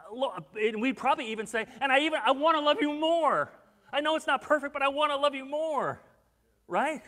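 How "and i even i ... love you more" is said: high, loud, earnest avowal; fervent pleading. Retold or imitated. Believer answering Jesus' question, fervent and pleading. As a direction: push it out with eager conviction.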